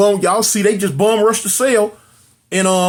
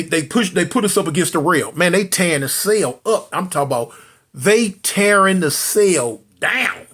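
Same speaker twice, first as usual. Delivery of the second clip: usual speaking voice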